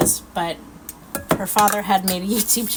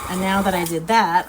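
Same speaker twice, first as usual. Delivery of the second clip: ordinary delivery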